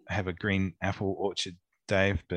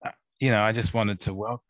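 normal talking voice

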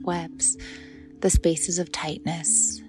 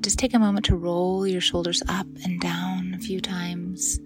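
whisper. Acted. Softly spoken meditation cue.

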